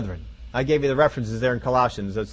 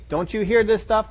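ordinary talk

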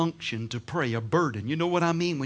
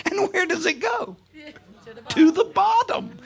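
rising sermon emphasis; 13 semitones higher, 4 dB louder than usual. Acted. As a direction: lift it up high and stretch it out. Preacher dramatizing sugar sinking.

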